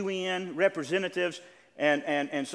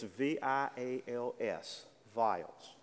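slow, softer letter-by-letter clarification; spelling it out; 3 semitones lower, 6 dB quieter, much slower than usual. Shown as a demonstration. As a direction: spell it slowly and quietly for the listeners. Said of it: "You may not know what I'm saying".

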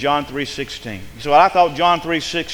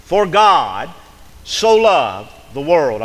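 For "God" lifted and louder, then the rest slow and loud; stress on God; 5 dB louder, slower than usual. Shown as a demonstration. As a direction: lean hard on "God" and draw out "so loved the world". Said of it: "The first word is God".

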